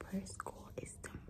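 soft late-night whisper. Real, not acted. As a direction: whisper so nobody wakes.

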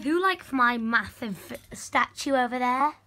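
usual speaking voice

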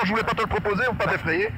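ordinary delivery

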